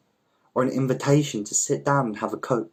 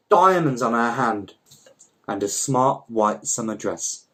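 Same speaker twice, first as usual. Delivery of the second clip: plain reading voice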